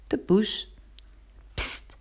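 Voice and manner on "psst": a quiet hushing psst; soft psst. A narrator's reading. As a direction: hiss it softly to call the cat. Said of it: "riep Pinkeltje zachtjes".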